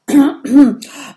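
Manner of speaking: loud gruff throat clear. Acted. Viking composes himself before bargaining, loud throat clear. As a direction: clear the throat to sound important. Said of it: "räuspert sich".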